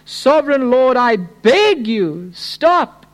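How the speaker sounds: crying out. Acted. Preacher as the prophet begging God to stop, crying out. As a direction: plead up to God, peaking high on the last word. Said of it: "Then I cried out".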